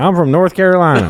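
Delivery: high, loud put-on Chinese accent; mock Chinese accent. Comedian answers friend's accent request, high mock Chinese accent. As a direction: launch loudly into a joking Chinese accent. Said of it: "Can you do a Chinese voice?".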